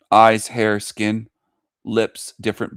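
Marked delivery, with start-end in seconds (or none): none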